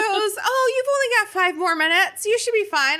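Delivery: high, dismissive chirpy reassurance; mock-cheerful brush-off; breezy nurse. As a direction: wave it off like a careless nurse.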